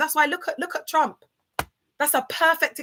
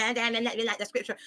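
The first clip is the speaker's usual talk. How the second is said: mocking quote; 6 dB quieter, faster than usual. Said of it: "trying to say".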